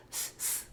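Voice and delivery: "s" hissed unvoiced; a soft, voiceless hiss of "s"; s sound. Shown as a demonstration. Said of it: "this first S is pronounced".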